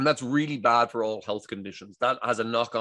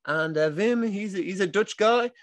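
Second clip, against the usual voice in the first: much higher, imitating a Dutch accent; Dutch lady impression; 10 semitones higher than usual. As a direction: mimic a chirpy Dutch woman with a Dutch accent. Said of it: "we're from Amsterdam".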